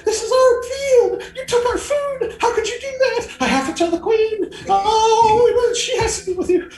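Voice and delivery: very high, loud indignant bee; agitated small bee. Acted. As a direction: scold the intruders in a tiny shrill yell. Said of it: "you hear this small voice yelling".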